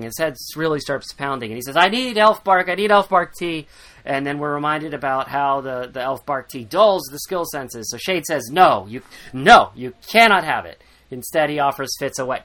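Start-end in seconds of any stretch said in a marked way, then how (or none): 1.8-3.7 desperate addict. Retold or imitated. Fitz demands his tea. 8.5-10.8 firm refusal. Retold or imitated. Chade refuses Fitz firmly.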